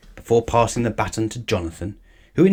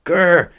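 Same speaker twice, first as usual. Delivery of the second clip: louder muffled spluttering growl; spluttered grr; 8 semitones higher, 8 dB louder than usual. A narrator's reading. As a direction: splutter angrily through a mouthful. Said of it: "said Missus Bird".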